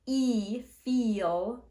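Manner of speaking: "e feel" with a long tense ee; long ee demo. Shown as a demonstration. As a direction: hold the ee in "feel". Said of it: "Instead of saying".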